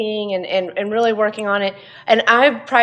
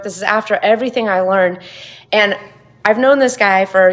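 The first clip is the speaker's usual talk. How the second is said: ordinary talk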